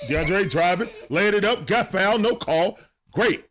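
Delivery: high, quick-phrased drive call; keyed-up live call; urgent play-by-play. Real, not acted.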